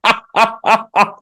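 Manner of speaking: high bursting laugh; delighted laugh. Real, not acted. Reacts to impression.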